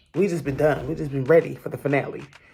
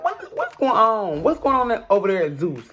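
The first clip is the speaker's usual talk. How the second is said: baffled; 7 semitones higher than usual. Real, not acted. Continued disbelief.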